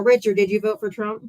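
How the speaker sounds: ordinary delivery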